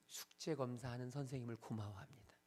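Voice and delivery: hushed unvoiced answer; whisper. Real, not acted. Whispered punchline.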